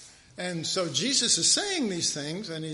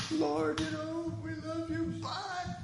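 high, soft, airy brush-off; mock breezy goodbye; 10 semitones higher, 6 dB quieter, slower than usual. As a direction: toss it off lightly, then gone. Disciples brushing Jesus off.

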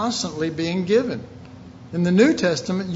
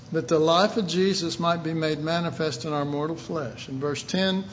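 normal talking voice

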